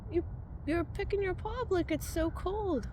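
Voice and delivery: pet talk. Real, not acted. Talking to the cat.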